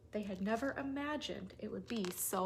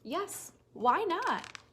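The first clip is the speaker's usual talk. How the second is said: high, louder delight; joyful yes; 7 semitones higher, 6 dB louder, much slower than usual. A narrator's reading. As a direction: burst out happily. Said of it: "said something never said".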